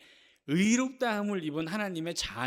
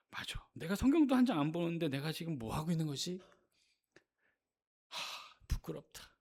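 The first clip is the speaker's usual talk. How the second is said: low, subdued self-reproach, acted out; guilt-ridden; 3 semitones lower, 6 dB quieter than usual. Believer doubting their own faith, guilt-ridden. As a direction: murmur to yourself as if weighed down by guilt. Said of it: "늘 죄책감에 시달립니다".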